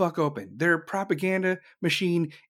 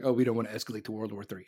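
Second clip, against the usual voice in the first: mocking timid leaders; 8 semitones lower, 6 dB quieter, much faster than usual. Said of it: "sitting here like".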